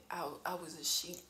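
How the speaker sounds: breathy whispered confession. Real, not acted. Mom admitting her mistake to the camera, whispering. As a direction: whisper it like a secret. Embarrassed aside after goat mistake.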